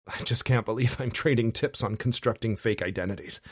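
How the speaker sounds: ordinary delivery, nothing marked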